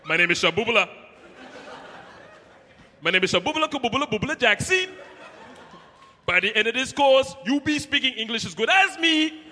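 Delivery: loud, high mock African-accented teacher; imitating the ESL teacher. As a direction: ham up a heavy African accent, booming. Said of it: "He's like".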